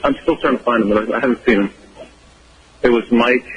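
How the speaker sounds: ordinary talk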